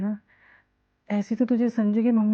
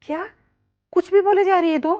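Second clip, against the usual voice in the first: high-pitched, indignant scolding; mother's scandalized, raised-pitch reproach; shocked rebuke; 9 semitones higher, 4 dB louder than usual. Acted. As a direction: pull her up sharply in disbelief. Mother rebukes daughter's decision.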